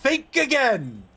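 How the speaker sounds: slow, high, loud mock warning; hammed-up threat. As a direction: shout it like a villain. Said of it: "threat tag, bigger".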